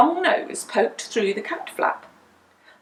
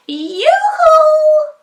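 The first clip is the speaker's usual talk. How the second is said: high, loud yoo-hoo call; calling into the dark sky; 9 dB louder than usual. A narrator's reading. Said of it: "Winnie called again".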